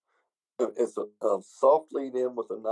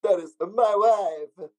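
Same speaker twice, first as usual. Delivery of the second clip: higher, louder, drawn-out comic foreigner; Borat; 6 semitones higher, 4 dB louder, much slower than usual. Acted. Showing off his wife, in hammed-up Borat voice. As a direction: exclaim it with a stretched-out mock accent. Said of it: "then we have the Borat".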